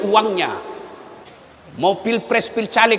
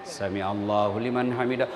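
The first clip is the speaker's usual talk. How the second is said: very low, soft intoned prayer call; imam's call; 12 dB quieter than usual. Retold or imitated. Imam rising from bowing, low soft intoning.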